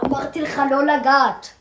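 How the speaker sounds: loud scolding; angry. Real, not acted. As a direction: snap at him loudly. Angry at friend touching.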